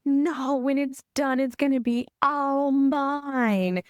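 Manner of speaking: put-on high, slow, teary little-girl wail; mock a sobbing small child; crying child. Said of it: "And she's like".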